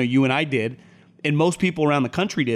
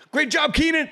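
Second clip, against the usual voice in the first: much higher, mock sideline praise; coach's encouragement; 14 semitones higher than usual. Coach balancing praise for the offense, high-pitched encouragement. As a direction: imitate a coach hyping up a player. Acting out coach cheering on players.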